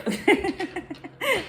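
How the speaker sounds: loud high burst of laughter; high-pitched laugh. Real, not acted. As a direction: laugh at yourself, high and free. Laughs at herself after outburst.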